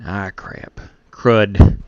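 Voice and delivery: breathy under-the-breath curse; whispered curse. Real, not acted. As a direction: hiss it to yourself. Muttered after a mistake.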